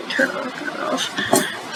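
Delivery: breathy whisper to herself; whispered aside. Real, not acted. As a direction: mutter it under your breath. Muttered aside while reading.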